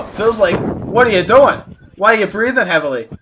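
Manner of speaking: ordinary talk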